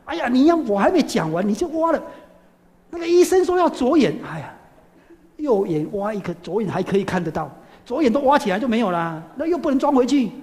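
high, loud, shrill-free fussing; exasperated old woman. Retold or imitated. Old woman berates the monk about the left eye, high and loud. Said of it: "他说".

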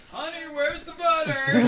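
loud, slow, whining husband call; mock clueless husband. Husband calling to wife for butter, loud and hammed-up. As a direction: ham up the helpless husband yelling for butter. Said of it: "and he's like".

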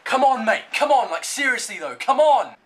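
loud, high exasperated urging; impatient anger. Real, not acted. Gamer whose time is being wasted, impatient anger. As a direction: shout at the opponent to get on with it. Opponent wasting his time.

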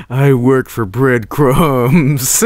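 slow, stretched-out character answer; duck's mock confession. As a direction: draw every word out, hammed up.

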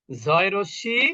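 ordinary talk

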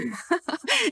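short high amused laugh; a brief bright chuckle; light laugh. Acted. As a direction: laugh lightly, half-knowing.

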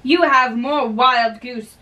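ordinary talk

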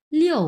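লিও high and loud, falling sharply; forceful falling-tone লিও; fourth tone. Shown as a demonstration. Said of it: "চার number tone যেহেতু".

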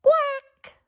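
high loud quack; duck quack. Acted. Duck surprising everyone with a high quack.

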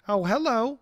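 ordinary delivery

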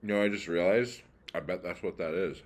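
chewing while talking; talking through dry cracker; mouth full of cracker. Real, not acted. Seen 0: Bit cracker at -9; still chewing early, then only holds it.

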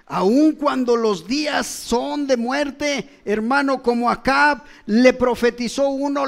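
fervent sermon voice; preaching. Acted. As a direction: preach the Bible story with force. Preacher at full force.

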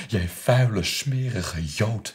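loud, slow, hate-filled yell; furious shout. Retold or imitated. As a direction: spit each word out in a rage. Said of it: "heb ik toen geroepen". (woedend en traag uitgeschreeuwd)

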